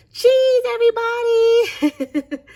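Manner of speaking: loud, high drawn-out call; cheery photo call. Acted. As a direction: sing out to the whole group for the photo. Calling out for group photo.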